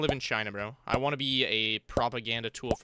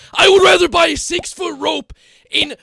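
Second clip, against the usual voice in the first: far higher, louder hammed-up scream; bigger mock despair shout; 15 dB louder than usual. Streamer repeats the joke louder, hammed-up scream. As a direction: top the first take with a full comic scream.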